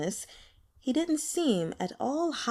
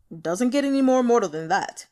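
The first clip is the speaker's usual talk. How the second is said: louder, gruff-edged cat retort, dry and unimpressed; sardonic cat voice; 6 dB louder than usual. Acted. Sarcastic talking cat replies.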